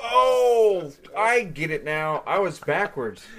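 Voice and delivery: surprised realization. Real, not acted. Sudden realization about the companies.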